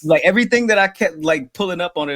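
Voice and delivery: bursts out high, loud and fast in disbelief; fed up with everyone calling it buddy cop; exasperated outburst. Real, not acted. Venting to friends about the movie label, exasperated burst.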